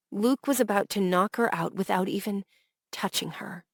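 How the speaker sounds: ordinary delivery, nothing marked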